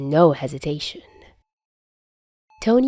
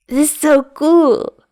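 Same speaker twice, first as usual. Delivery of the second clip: much higher, louder, slow and gushing; thrilled kid; 10 semitones higher, 7 dB louder than usual. Acted. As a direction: squeal it like a kid meeting his hero. Loopy Peter starstruck by Tony.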